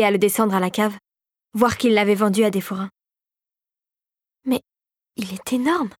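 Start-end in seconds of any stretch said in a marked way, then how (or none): 4.4-5.9 hushed touch of wonder; marvelling. A narrator's reading. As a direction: marvel at it under your breath. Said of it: "s'émerveilla-t-elle".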